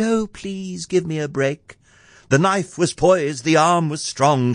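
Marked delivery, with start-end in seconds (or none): 0.0-1.8 high, softened plea; a mildly acted frightened plea; fearful cry. A narrator's reading. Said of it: "She cried".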